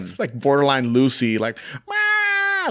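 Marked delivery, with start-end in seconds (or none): 1.9-2.7 very high-pitched mock wail; imitated scream. Co-host imitating the over-the-top innkeeper, mock screaming. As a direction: squeal out a long mock scream. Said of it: "like, screaming".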